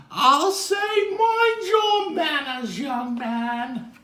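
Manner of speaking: very high, loud, slow scolding; wag-the-finger teacher voice; prim schoolmarm. Acted. Missus M scolding.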